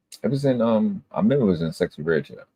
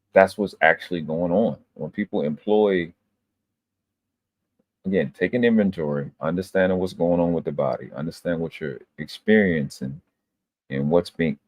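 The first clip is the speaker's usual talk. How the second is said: ordinary talk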